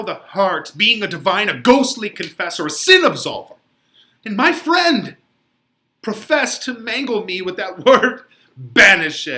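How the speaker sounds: a banished lover raging at his confessor; Romeo in despair. Acted. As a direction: build to desperate, near-shouted grief. Reads Romeo's anguished speech.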